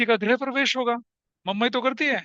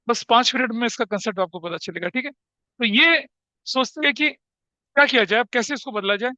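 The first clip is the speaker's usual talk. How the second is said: ordinary talk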